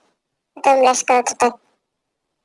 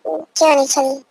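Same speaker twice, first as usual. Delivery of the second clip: ordinary talk, nothing marked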